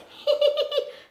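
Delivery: high little giggle; high mouse giggle. Acted. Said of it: "The mouse giggled".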